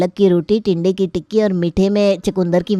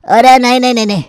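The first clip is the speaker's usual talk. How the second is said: emphatic refusal; 4 semitones higher, 6 dB louder, faster than usual. Acted. Uncle rejects the breakfast menu.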